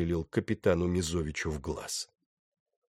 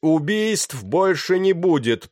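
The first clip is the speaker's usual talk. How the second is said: slow, high, loud call; shouted announcement; 9 semitones higher, 6 dB louder, slower than usual. A narrator's reading. Pirate to the panicking deck, shouting through cupped hands. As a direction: call it out across a crowd. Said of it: "прокричал он, сложив руки рупором".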